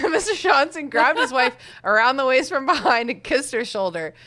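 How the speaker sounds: reading through giggles; breaking into laughter mid-sentence; laughing. Real, not acted. Laughing while reading aloud.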